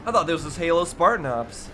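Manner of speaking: ordinary talk, nothing marked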